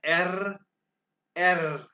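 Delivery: loud "एर" with the tongue vibrating; trilled German R. Shown as a demonstration. Teacher demonstrating tongue vibration to students, emphatic trill. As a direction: roll the r hard at the end of "एर". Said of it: "यहाँ पे जुबान को vibrate करना".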